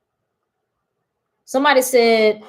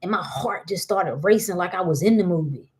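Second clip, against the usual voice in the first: usual speaking voice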